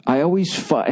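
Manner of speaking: ordinary talk, nothing marked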